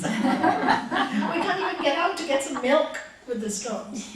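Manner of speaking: slow, loud, laughing through the grumble; laughing complaint. Retold or imitated. Disciples grumbling about the storms, laughing.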